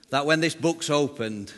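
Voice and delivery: ordinary delivery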